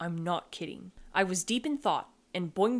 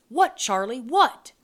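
loud, urgent questioning; loud, frantic parent's reply; alarmed shout; 4 semitones higher, 8 dB louder than usual. Acted. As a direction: call back in alarm, rising higher. Alarmed mother answering yelling child.

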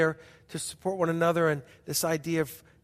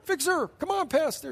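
pleading group; 11 semitones higher than usual. Retold or imitated.

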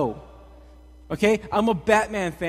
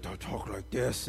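much lower, slow growl of a movie Batman; Batman deep voice; 8 semitones lower, 8 dB quieter than usual. Retold or imitated. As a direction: drop the voice down low and slow like Batman. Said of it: "real deep voice".

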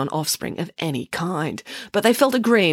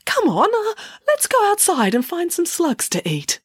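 high, cheery mother duck; 12 semitones higher than usual. A narrator's reading. Mother duck calls her ducklings outside, high and cheery. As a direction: bustle the chicks along brightly.

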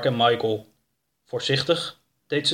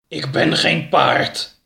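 growling tiger house; slower than usual. A narrator's reading. Tiger house correcting the dreamer, growling. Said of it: "gromde de muil".

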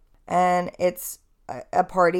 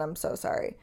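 usual speaking voice

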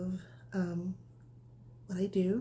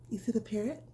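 ordinary delivery, nothing marked

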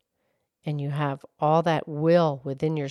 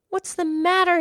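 calling out; 4 dB louder than usual. Acted. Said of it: "he called".

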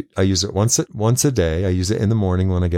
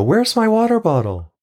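high sing-song call to a phone; calling out to the app; 11 semitones higher than usual. Retold or imitated. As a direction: ask the app brightly, pitched way up. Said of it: "And I go".

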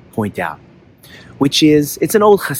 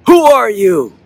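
high, loud shouted question; echoed call; 8 semitones higher, 6 dB louder, slower than usual. A narrator's reading. Echo throws the boy's question back, shouting. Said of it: "he hears a voice say".

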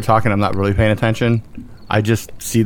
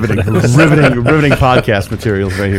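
mock announcer; 5 dB louder than usual. Mocking podcast announcer.

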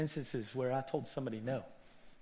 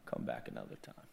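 very low, voiceless murmur; hushed dismissal; 10 dB quieter than usual. Acted. The one who puts off the needy, hushed. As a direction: say it under the breath, near whisper.

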